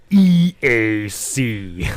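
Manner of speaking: very slow spelled-out letters; slow announcer parody. Pretend. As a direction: stretch the letters like a jingle. Slow drawn slogan parody.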